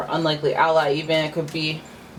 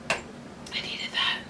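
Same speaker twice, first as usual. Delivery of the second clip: relieved breathy whisper; 9 dB quieter, much slower than usual. Real, not acted. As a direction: sigh it out in a whisper. Relief after a drink.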